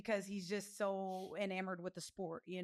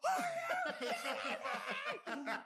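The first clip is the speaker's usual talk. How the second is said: laughing disbelief. Retold or imitated. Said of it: "And I was like".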